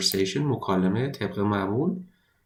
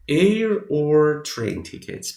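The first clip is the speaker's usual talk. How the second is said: slow model reading; 6 semitones higher, 4 dB louder, much slower than usual. Shown as a demonstration. Teacher models English phrase slowly.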